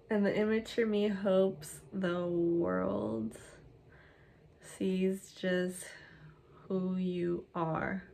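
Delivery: slow, stretched-out reading; word by word, unhurried; dictating while typing. Real, not acted. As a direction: draw each word out as you type it. Typing the next line aloud.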